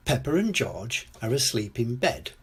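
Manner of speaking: ordinary delivery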